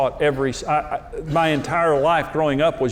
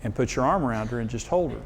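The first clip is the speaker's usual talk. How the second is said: soft, hushed inner voice; quiet inner voice; 3 semitones lower, 7 dB quieter than usual. Retold or imitated. Husband retells the Holy Spirit speaking, hushed and soft. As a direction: lower and softer, fading at the end. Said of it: "he said".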